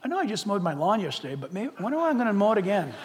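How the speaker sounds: distracted inner voice. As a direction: drift off thinking about chores. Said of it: "And I'm like".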